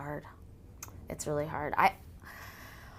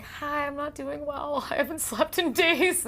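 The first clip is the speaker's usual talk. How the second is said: mock exhausted self; 9 semitones higher, slower than usual. Exhausted new mother greeting star.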